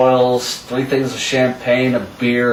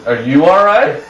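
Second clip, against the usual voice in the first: higher, rising echo of the question; imitated cheeky comeback; cocky retort; 4 semitones higher, 3 dB louder than usual. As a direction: turn the question back on him. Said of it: "You cocky little".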